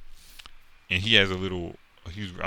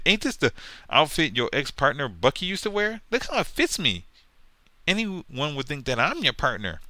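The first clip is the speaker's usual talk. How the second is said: higher, mocking sidekick voice; needling sidekick voice, pitched up high; taunting sidekick; 8 semitones higher, 4 dB louder than usual. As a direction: tease him in a higher put-on voice. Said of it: "And he said".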